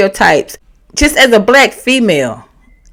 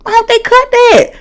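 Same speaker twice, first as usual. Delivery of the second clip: high, astonished and judging; high shocked gossip; 11 semitones higher than usual. Retold or imitated. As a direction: gasp it out way up high. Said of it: "I'm like".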